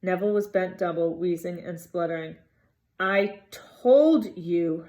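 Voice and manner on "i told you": slow, breathless, gasped out; gasping. A narrator's reading. Hermione gasping at the boys after the sprint, breathless. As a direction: push it out between gasps. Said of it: "Hermione gasped".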